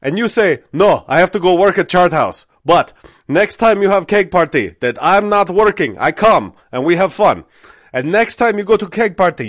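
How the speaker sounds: put-on high, loud, heavily accented manager pitch; Farhad's foreign accent. Manager selling the job with keg party story, foreign accent. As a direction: imitate an earnest manager in a thick foreign accent. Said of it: "so he continues, he's like".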